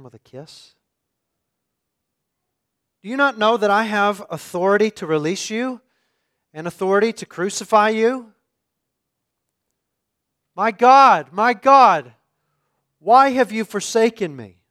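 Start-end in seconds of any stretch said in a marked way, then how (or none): 3.0-8.3 loud, high, menacing demand; bully him with your power; threatening Pilate. Acted. Preacher voicing Pilate threatening Jesus, loud and menacing. 10.5-14.6 loud, high, anguished outcry; anguished cry. Acted. Preacher voicing Jesus on the cross crying out in anguish.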